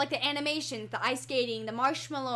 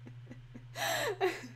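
very high, breathy giggle; guilty laugh; 13 semitones higher, 5 dB quieter than usual. Real, not acted. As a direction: giggle you know you shouldn't. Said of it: "I should not be laughing at that".

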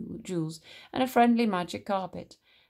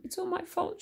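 high, tearful lament; weepy self-blame; acted sobbing; 12 semitones higher, 4 dB quieter than usual. A narrator's reading. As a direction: sob it in a small high voice. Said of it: "she sobbed to Raja".

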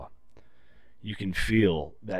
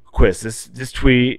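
louder, lightly voiced boss; imitating the boss; 3 semitones higher, 11 dB louder, slower than usual. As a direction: call him out like the boss pointing at the tree. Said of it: "I can pull this up in my head".

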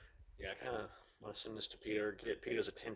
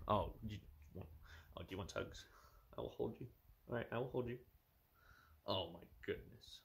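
softer cooing to the cat; 9 dB quieter than usual. Real, not acted. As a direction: coo it gently like holding a kitten. Baby talk to a cat.

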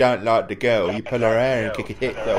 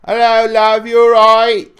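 cheery greeting; 10 semitones higher, 10 dB louder than usual. Real, not acted. Greeting friend's girlfriend in background.

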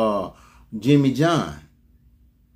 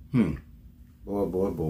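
ordinary talk, nothing marked

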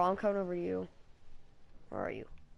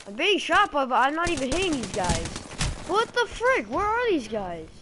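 panicked yelling while under fire; frantic; 6 semitones higher, 4 dB louder than usual. Real, not acted. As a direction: shout it, getting shot and losing it. Shot at, can't hit back.

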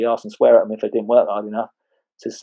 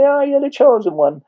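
mocking mates; 4 dB louder than usual. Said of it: "they started laughing at him".

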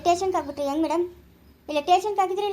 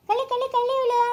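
sing-song cooing, climbing high; doting, high-pitched baby-teasing voice; playful baby talk; 8 semitones higher than usual. Acted.

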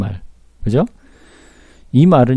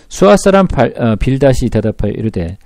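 usual speaking voice

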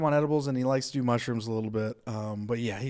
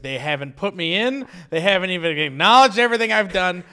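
higher, louder self-mocking rant; mock outrage, building louder; indignant complaint; 5 semitones higher, 7 dB louder than usual. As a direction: replay a worked-up gripe. Quotes own high indignant complaint.

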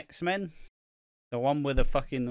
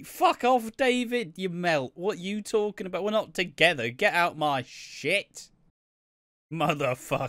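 mock furious Carol; 5 semitones higher than usual. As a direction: shout him down, soap style. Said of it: "even Carol's like".

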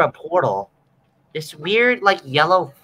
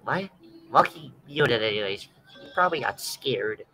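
ordinary talk, nothing marked

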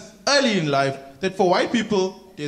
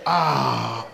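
drawn-out, sighing "eesh" and "ah"; mock dismay, sinking low at the end; resigned customer; 6 semitones lower, slower than usual. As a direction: give up with long falling groans. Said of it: "He's not gonna argue".

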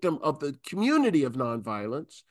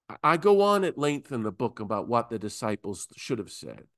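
usual speaking voice

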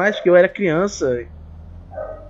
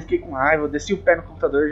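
ordinary talk

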